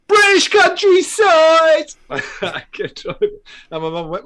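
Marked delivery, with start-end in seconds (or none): 0.1-1.9 very high, loud, stretched-out shout; retold drive-by yell. Man in a passing car yelling out, high drawn-out shout. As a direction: bellow it slowly at full pitch.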